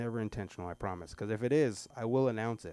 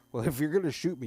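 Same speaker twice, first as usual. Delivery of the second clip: raised, louder cocky dare; a louder mock threat to the teacher; mock tough challenge; 5 semitones higher, 6 dB louder, faster than usual. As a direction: square up to him like a tough kid. Kid challenging teacher in story.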